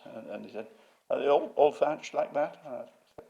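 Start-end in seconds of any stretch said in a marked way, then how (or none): 1.1-2.6 imitated sneering query, high and loud; mock a sceptical grandee, voice raised; David Wilson. Museum head querying every roof, imitated with a sneer. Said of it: "he is a sneerer".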